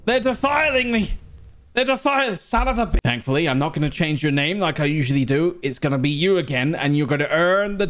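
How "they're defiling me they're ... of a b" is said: panicked screaming wizard. Acted. A wizard casting spells at attackers in a high panic. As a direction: shriek in wild panic.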